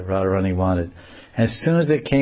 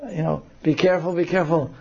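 worried warning; 7 semitones higher than usual. Acted. Friends warn her.

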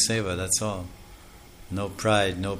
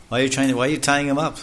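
fast, louder run of questions; rapid scolding; 5 semitones higher, 5 dB louder, faster than usual. Retold or imitated. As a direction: fire the questions off quickly. Sage's scolding questions speed up.

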